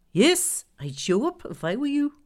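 high, pointed character voice; insistent Glenda character. Acted. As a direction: press the point in a lifted, lilting voice. Glenda's voice in narrated story.

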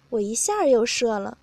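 ordinary delivery, nothing marked

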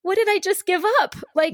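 high-pitched incredulous question; acted dismay. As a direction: act out suddenly realising the loss. Voicing a woman's sudden realisation.

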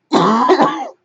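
harsh rising cough; coughing fit. Real, not acted. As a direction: hack it out loud. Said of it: "coughing attack".

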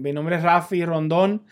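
ordinary talk

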